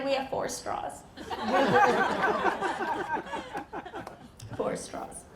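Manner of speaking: usual speaking voice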